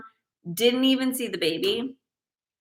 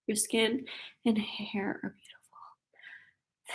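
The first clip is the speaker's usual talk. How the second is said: soft breathy whisper, slowed; 9 dB quieter, much slower than usual. Real, not acted. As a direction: read the compliment in a hushed whisper. Reading a compliment from chat.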